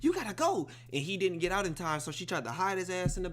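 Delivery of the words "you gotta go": higher urgent order; mother hustling boyfriend out. Retold or imitated. Mom ordering the boyfriend out, higher and urgent. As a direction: shoo him out fast. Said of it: "She told the boyfriend".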